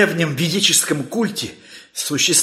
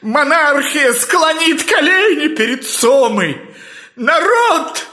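slow, building to a high ecstatic cry; rising fervor; 6 semitones higher, slower than usual. Acted. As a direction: preach it to a fever pitch. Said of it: "Профессор вошёл в выступление".

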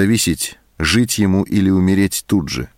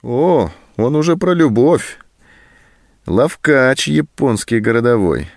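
higher, mocking sneer, slowing at the end; sarcastic jab; 6 semitones higher than usual. A narrator's reading. Sergeant mocking the prisoner's talk of love, sarcastic. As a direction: needle him with scorn. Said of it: "съязвил сержант".

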